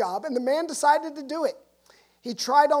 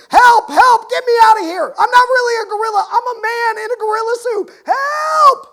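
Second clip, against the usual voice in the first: frantic, much higher and much louder yelling for help; panicked screaming; 11 semitones higher, 14 dB louder than usual. Acted. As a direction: scream for help like a man facing a hungry lion.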